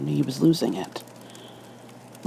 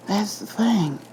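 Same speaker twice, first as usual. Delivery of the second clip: higher-pitched, uneasy storyteller voice; a spooked cop reliving it; officer telling his ghost story, rattled and higher; nervous officer Liu; 6 semitones higher than usual. A narrator's reading. Liu voice again.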